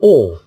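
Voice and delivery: "او" loud with rounded lips; rounded "او" sound. Shown as a demonstration. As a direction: round the mouth fully on "او". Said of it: "خصوصًا في الكلمة ديال او". ("او" بفم مدور وبصوت مرتفع)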